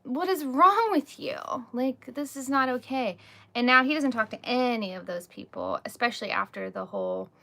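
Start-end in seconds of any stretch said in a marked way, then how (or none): 0.0-3.2 high, slow, indignant retelling of her own outburst; exasperated disbelief, stretched out and pitched high; retold outrage. Mom recalling scolding the therapist, indignant and raised. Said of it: "And I'm like".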